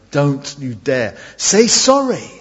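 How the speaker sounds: put-on warn, then insist, as a parent to a child; scolding parent.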